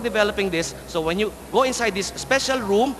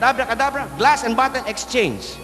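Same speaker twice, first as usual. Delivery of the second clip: magician's incantation; 5 semitones higher than usual. Acted. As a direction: proclaim it like a stage magician. Magician casts the trick.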